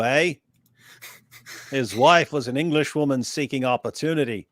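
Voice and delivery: broad mock Aussie accent; Australian accent. Reading Chloe's comment on request, bad Australian accent. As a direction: read it with a put-on Australian twang. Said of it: "Can you do an Australian accent".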